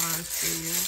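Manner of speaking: ordinary talk, nothing marked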